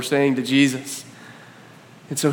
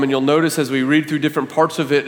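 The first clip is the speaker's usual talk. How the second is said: ordinary talk, nothing marked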